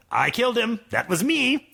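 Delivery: lightly voiced proud boast. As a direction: say it like a proud hero. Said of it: "said Crayman proudly".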